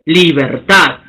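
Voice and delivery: Libertad pitched low, climbing onto the final -tad; stress on last syllable. Shown as a demonstration. Teacher demonstrating agudas to students, Libertad stressed on final -tad. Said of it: "La curva asciende".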